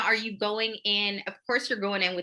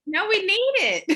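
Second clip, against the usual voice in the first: laughing; 12 semitones higher, 5 dB louder than usual. Real, not acted. Co-host laughing at loud talk.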